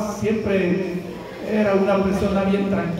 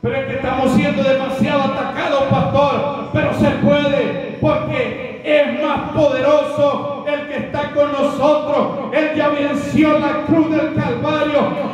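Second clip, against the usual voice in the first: fervent preaching; 6 semitones higher than usual. Acted. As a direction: lift the congregation with conviction. Preaching at full force.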